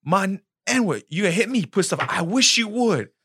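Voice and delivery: jeering challenge, imitated; mock taunt. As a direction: dare him in a mocking high voice.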